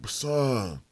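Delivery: high, loud, drawn-out begging; imitating an excited stranger; over-invested pleading. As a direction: beg him at full volume, stretching it out.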